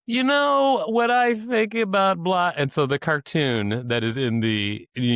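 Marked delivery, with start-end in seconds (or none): 0.1-2.5 higher, slow put-on voice of a stranger; hammed-up barfly voice; mock bar guy. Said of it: "and then he goes".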